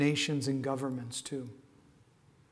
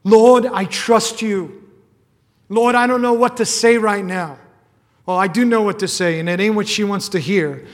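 loud, impassioned preaching at full force; fervent preaching; 5 semitones higher, 13 dB louder than usual. Acted. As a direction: cry out to the Lord with full conviction. Impassioned sermon opening prayer.

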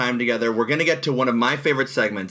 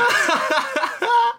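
high delighted laugh. Real, not acted. Delighted after the freestyle.

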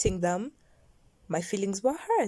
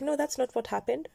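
higher-pitched imitation of his denial; raised, questioning mock protest; mock innocent denial; 5 semitones higher, faster than usual. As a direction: mimic him protesting, lifting at the end. Imitates him denying it, gaslighting.

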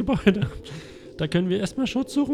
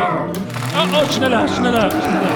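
higher, louder frantic urging; panicked urging; 4 semitones higher, 8 dB louder than usual. Acted. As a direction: urge them on in panic. Door giving way, panic.

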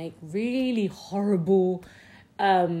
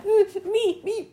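mocking eager kid; 13 semitones higher, 3 dB louder, slower than usual. Mimicking an eager classmate.

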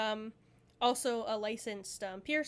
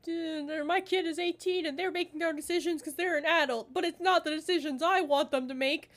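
higher-pitched parental whine in imitation; mock complaining parent; 5 semitones higher, 3 dB louder than usual. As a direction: put on an aggrieved parent. Mimics complaining controlling parent.

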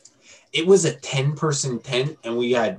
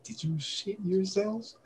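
1 incredulous question; 9 semitones higher, 9 dB quieter than usual. Retold or imitated. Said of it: "I was like".

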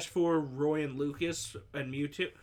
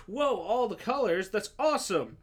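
higher, bright mock amazement; mock excitement; 5 semitones higher, 3 dB louder than usual. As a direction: gush like an easily impressed buyer. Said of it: "the ones who go".

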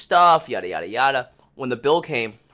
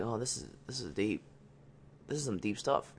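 hushed retold reaction; quiet aside; 2 semitones lower, 14 dB quieter than usual. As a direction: say it under your breath, a little awed. Quiet reaction to heavy advice.

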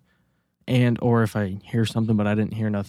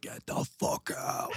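whispered scream, drawn out slowly; mock scream; 11 dB quieter, slower than usual. Retelling yelling in the backyard while furious, mock whispered scream. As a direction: imitate screaming under your breath, barely voiced. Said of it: "I was screaming".

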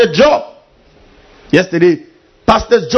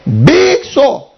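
high, exaggerated disgust at a sore; mock disgust; 9 semitones higher, 3 dB louder than usual. Preacher mimicking disgust at a man's sore. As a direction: recoil at the wound.

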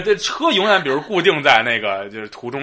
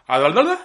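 fast rolling motor trill; motorbike imitation; 2 semitones higher than usual. Shown as a demonstration. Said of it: "试图模仿这个摩托车的声音". (模仿摩托车声)

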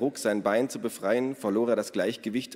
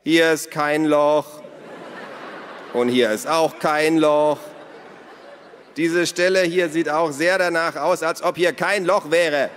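high, very loud sarcastic reports; calling out; 8 semitones higher, 8 dB louder than usual. A narrator's reading. Naru mockingly reports from the garden, calling out. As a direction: shout each finding towards the house. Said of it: "Dann rief er".

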